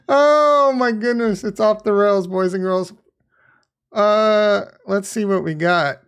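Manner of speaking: ordinary talk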